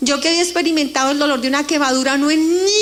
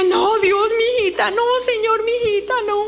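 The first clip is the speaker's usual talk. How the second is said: father weeping; 7 semitones higher than usual. Retold or imitated. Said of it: "Mi papá empieza a llorar".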